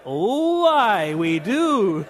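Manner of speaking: hammed-up Scottish, very drawn out and swooping; Scottish accent. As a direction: stretch it out in a mock Scottish lilt. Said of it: "She gets a Scottish accent".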